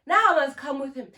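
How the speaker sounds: ordinary delivery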